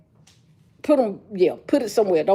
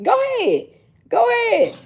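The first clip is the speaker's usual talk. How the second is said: high taunting dare; 11 semitones higher than usual. Retold or imitated. Daring her kids to try.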